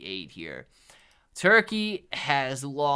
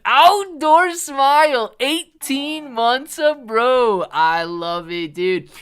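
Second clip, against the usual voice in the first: loud, high, drawn-out announcer-like cheer; hyped shout-out; 10 semitones higher, 13 dB louder, slower than usual. Real, not acted. Streamer thanks an eighteen-month supporter, hyped shout-out. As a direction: belt it out high and slow.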